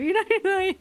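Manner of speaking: high, giggly excuse; breaking into laughter. Real, not acted. Excusing amusement at a long-ago death, breaking into laughter. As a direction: let the words dissolve into laughing. Cracking up over old death.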